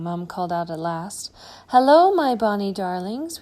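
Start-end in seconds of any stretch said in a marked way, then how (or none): 1.7-3.4 high, loud, cheery granny hello; loving granny calling out; grandmother's greeting. Acted. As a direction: greet the grandchildren with open arms.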